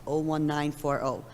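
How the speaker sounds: ordinary talk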